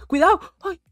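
sudden loud high shout. Pretend. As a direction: yell a warning. Near car accident.

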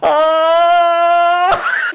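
high sharp scream; pained scream. Real, not acted. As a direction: cry out from the squeeze. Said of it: "I'll be quiet".

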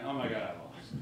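a soft, deflated oh my God; quiet shock. Retold or imitated. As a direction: say it under your breath as the loss sinks in. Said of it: "I'm going".

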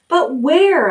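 high worried ask; anxious question. A narrator's reading. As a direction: ask it, afraid of the answer. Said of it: "asked Wilbur".

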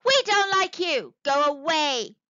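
loud, high shout; zebra shouting. A narrator's reading. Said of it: "The zebra shouted".

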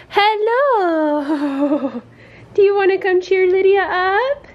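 high, cooing greeting; puppy talk. Real, not acted. Asking puppy to cheer Lydia up, high puppy talk.

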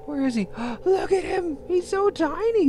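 very high-pitched squeal of delight; gushing delight. Real, not acted. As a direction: gasp and squeal over something adorably tiny. Gushing over the tiny pet.

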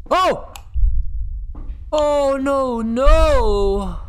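high, drawn-out wail of dread; high-pitched scared moan; frightened cry. Real, not acted. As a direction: panic as the monster appears. Enemy appears.

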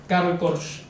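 normal talking voice

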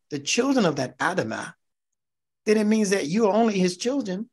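impassioned sermon delivery; fired-up preaching. Acted. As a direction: drive the point home from the pulpit. Preaching at full force.

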